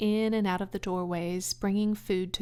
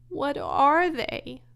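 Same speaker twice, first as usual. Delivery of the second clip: sad; 7 semitones higher, 6 dB louder, slower than usual. Acted. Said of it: "asked Wilbur sadly".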